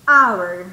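"hour" loud and low as "ow-er", no H; silent H. Shown as a demonstration. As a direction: hit the opening vowel of "hour" hard. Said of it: "Si ustedes escuchan la pronunciación".

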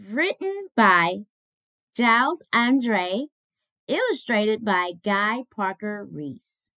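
put-on lively rhyming read to children; storybook read-aloud. Reads the picture book aloud.

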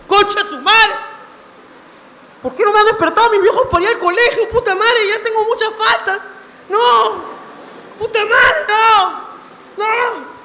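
high, loud panicked shouting; frantic loud high yelling in a retold panic. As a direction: scream it like you overslept for school. Panicked waking late for school.

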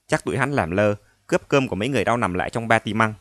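usual speaking voice

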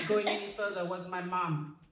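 ordinary talk, nothing marked

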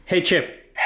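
high sing-song call to dog. Real, not acted. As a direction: call the dog's name. Calling commands to robot dog.

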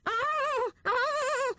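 very high, sharp squeals; squealing. Acted. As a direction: squeal in pain as the knot is tugged. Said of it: "he squealed".

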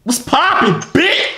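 high-pitched, loud blurted greeting; shouted catchphrase. Acted. Prankster greets a stranger, high shout. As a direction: yell it high, jumping on the last word.

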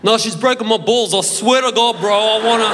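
put-on broad wog-style Aussie teen, loud and keen; pleading in a thick ethnic Australian accent. Teen on the phone to his mates in ethnic Australian accent. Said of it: "spoke in that ethnic accent".

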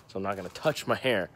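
usual speaking voice

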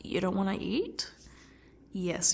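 normal talking voice

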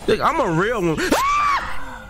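high frantic yell while fleeing; panicked shout. Real, not acted. Chased by monster.

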